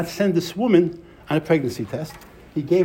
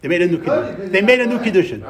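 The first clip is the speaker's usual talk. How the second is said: louder and higher on the repeat; insistent repetition; 5 semitones higher, 7 dB louder, faster than usual. Real, not acted. Teacher repeating the answer to a questioner, insistent repetition. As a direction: drive the answer home.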